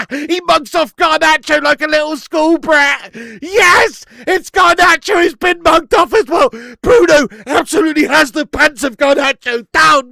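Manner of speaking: loud, high, gleeful cheering; excited shout. Real, not acted. Fan gloating over the replay, shouting with glee. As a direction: crow over the skill with delight.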